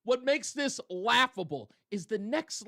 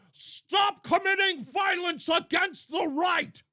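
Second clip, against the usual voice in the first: high, loud, slow hollered demand; mock hollering; 8 semitones higher, 4 dB louder, slower than usual. Host imagining the congressman hollering at followers, mock yell. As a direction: holler it at your own supporters. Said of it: "screaming and hollering".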